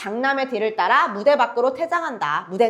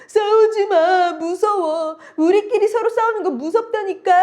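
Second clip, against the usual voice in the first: crying plea; 10 semitones higher, 5 dB louder than usual. Acted. Youngest daughter begs her siblings to stop fighting, tearful. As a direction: plead through tears for them to stop. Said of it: "갑자기 삼녀 막 울면서".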